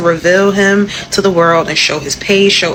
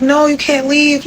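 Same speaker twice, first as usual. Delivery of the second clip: imitated crying child; 7 semitones higher than usual. Kid running after dad's car, imitated crying. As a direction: lightly imitate a child pleading through tears. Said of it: "ran to the car and crying".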